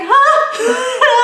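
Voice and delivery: high, loud startled huh through laughter; a startled huh, laughing. Retold or imitated. Girl bumped from behind, loud startled huh. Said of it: "the girl was like".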